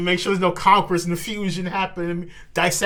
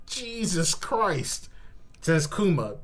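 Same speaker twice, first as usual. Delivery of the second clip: ordinary talk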